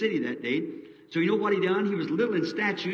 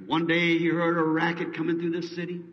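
ordinary talk, nothing marked

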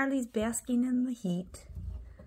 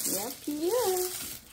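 higher slow sing-song; 8 semitones higher, slower than usual. Real, not acted. Voicing for the pet.

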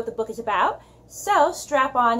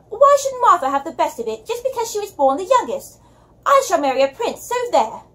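put-on high, loud British girl shouting; British accent; 8 semitones higher, 6 dB louder than usual. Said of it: "attempt to do a British accent".

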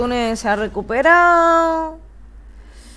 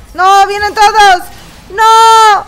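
loud high panicked cry; panicked shout; 10 semitones higher, 13 dB louder, slower than usual. Real, not acted. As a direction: yell in sudden gamer panic. Enemies charging at the player.

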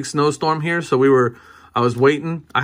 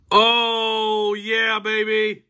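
excited shout; 9 semitones higher, much slower than usual. Real, not acted. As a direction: crow over the pull. Big hit from the pack.

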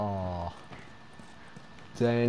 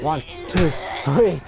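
tense counting; 5 semitones higher, 6 dB louder than usual. Real, not acted. Counting in panic.

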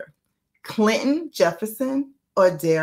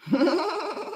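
ordinary delivery

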